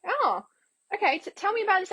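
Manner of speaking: high-pitched mock curiosity; playing along. Coaxing a scam caller for details, mock-innocent. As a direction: humour them with feigned interest. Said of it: "I wanna say, playing with them".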